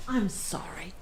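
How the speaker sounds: hushed apology, under the breath; whispered aside. Real, not acted. Host whispering sorry to viewers while fetching her dog. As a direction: whisper it off to the side.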